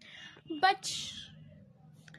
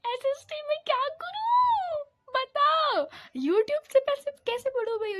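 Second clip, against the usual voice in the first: high, louder pleading whine; desperate plea; 10 semitones higher, 6 dB louder than usual. Real, not acted. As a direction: beg for an answer.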